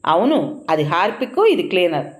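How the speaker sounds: ordinary delivery, nothing marked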